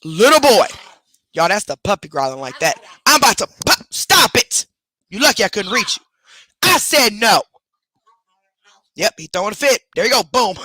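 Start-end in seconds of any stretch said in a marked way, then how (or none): 0.0-0.7 loud, high call to the puppy; sharp scold. Real, not acted. Scolding the dog. 3.9-7.4 high, loud scolding of the puppy; angry scolding. Real, not acted.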